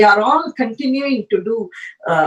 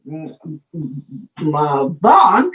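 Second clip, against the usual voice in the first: normal talking voice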